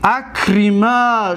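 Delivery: اکرما drawn out slowly with a long final a; slow Arabic demo. Shown as a demonstration. Teacher demonstrating the dual form, slow and deliberate. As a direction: say اکرما syllable by syllable. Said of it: "شما دو کس بدانید".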